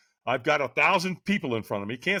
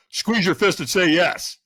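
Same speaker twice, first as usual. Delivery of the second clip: loud, slow, higher-pitched order; trainer's command; 5 semitones higher, 7 dB louder than usual. Retold or imitated. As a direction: drill it in like a coach.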